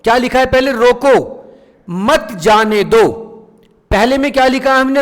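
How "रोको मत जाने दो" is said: रोको said as a command, pause, then मत जाने दो; pause after रोको. Shown as a demonstration. Teacher reads out the example sentence, pausing after रोको. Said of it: "रोको मत के बाद विराम चिन्ह लगाया".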